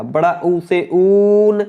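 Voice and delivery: ऊ in ऊन held long and slow; long vowel demo. Shown as a demonstration. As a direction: stretch the ऊ of ऊन. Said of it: "इसमें ज़्यादा समय लग रहा है". (ऊन में ऊ को लंबा खींचकर)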